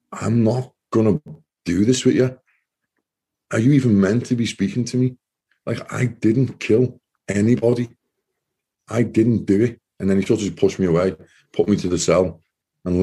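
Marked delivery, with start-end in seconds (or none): 0.1-9.8 indignant. Retold or imitated. Refusing officer's friend.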